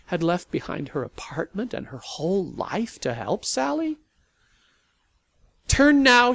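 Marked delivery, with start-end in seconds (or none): 5.7-6.3 high, loud call. Acted. Sally calling to Lavinia at the wheel, loud. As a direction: call the turn sharply. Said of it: "she called".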